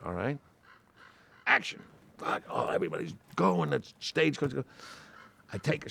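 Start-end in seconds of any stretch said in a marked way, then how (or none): 1.5-1.8 high sharp call of action; high-pitched mock director's call. Retold director calling action.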